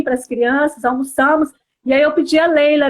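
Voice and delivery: ordinary talk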